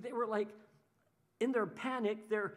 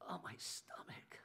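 worried whimper; 5 semitones lower, 4 dB quieter than usual. Retold or imitated. Anxious person fretting over their troubles in a soft whisper. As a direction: moan about your stomach under your breath. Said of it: "it affects you physically".

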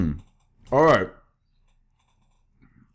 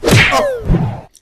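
startled yelp; 4 dB louder than usual. Real, not acted. Startled exclamation in game.

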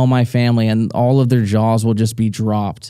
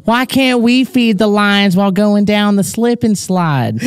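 put-on high, drawn-out child's complaint; imitated pleading child; whining kid; 9 semitones higher, slower than usual. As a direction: whine up high like a kid pestering Dad. Imagined whining kids questioning dad.

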